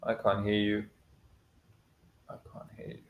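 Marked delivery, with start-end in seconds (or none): 2.3-3.0 breathy whispered repeat. Real, not acted. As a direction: whisper it under your breath. Repeats the mic test in a whisper.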